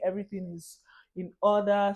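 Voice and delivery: ordinary talk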